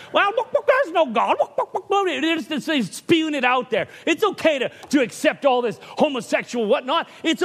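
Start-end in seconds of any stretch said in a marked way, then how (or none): 0.1-2.1 high squawky chicken voice, much higher; mock chicken squawking look look; chicken talk. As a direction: play a clucking chicken with high bursts of look. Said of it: "chicken talk".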